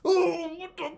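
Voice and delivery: high muffled cry as if underwater; acted-out underwater panic; underwater yelp. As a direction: yelp as though your mouth is full of water. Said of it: "under the fucking water going like".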